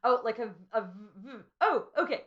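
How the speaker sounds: normal talking voice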